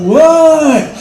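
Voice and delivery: loud high shouted answer; father shouting back to his daughter; calling back. Retold or imitated. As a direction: yell back over the phone.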